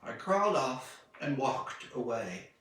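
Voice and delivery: ordinary delivery, nothing marked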